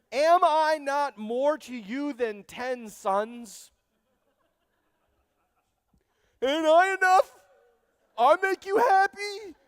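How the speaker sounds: high, loud, self-pitying husband begging for reassurance; mock needy husband. Elkanah asking his wife if he's enough, high and loud. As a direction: ham up a needy husband fishing for praise. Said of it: "Dumbest comment in all of Scripture".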